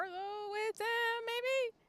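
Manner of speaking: squeaky, rising up high. Real, not acted. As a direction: pitch it way up, unsure.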